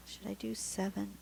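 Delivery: whispered question to herself. Real, not acted. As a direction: whisper it, thinking aloud. Unvoiced self-question.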